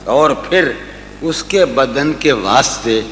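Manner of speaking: ordinary delivery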